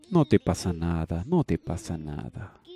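usual speaking voice

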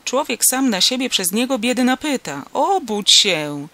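loud grumbling wake-up call; irritated farmer trying to wake someone; exasperated rousing. A narrator's reading. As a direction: complain loudly, drawing out the final command. Farmer shakes sleeping passenger awake.

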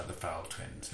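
ordinary delivery, nothing marked

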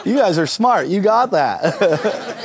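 ordinary talk, nothing marked

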